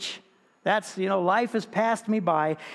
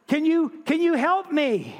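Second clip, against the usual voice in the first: high, pleading, stammering; desperate plea; 9 semitones higher, 3 dB louder than usual. Acted. Man clinging to a bush begging the voice above, high and frantic. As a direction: beg for help with your voice pitched way up.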